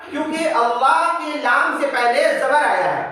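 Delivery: usual speaking voice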